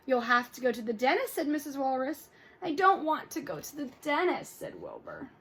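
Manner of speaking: lively read-aloud with character voices; storybook read-aloud for kids; reading to children. Acted. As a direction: read it to the class with expression. Teacher reads story aloud to class.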